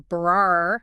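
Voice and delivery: "brar" with the T swallowed; "brar" stretched and loud, T gone; dropped T. Shown as a demonstration. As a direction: say "brar" slowly with no T. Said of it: "the T can even disappear".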